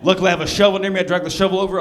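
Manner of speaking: ordinary talk, nothing marked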